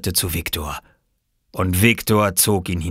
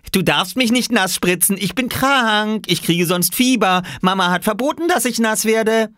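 put-on high, whining child's cry; squealed protest; 4 dB louder than usual. Small boy fleeing from a splashing, squealed protest.

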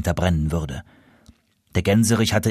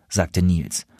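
ordinary delivery, nothing marked

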